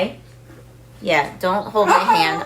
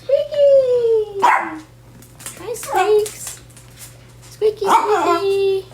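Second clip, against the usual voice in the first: much higher, cooing pet voice; 11 semitones higher, much slower than usual. Real, not acted. Owner cooing to Squeaky in baby talk. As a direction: coo at the little dog. Greeting the tiny dog.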